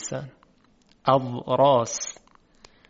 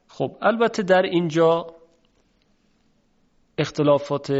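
normal talking voice

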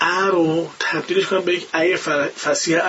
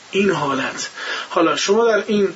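usual speaking voice

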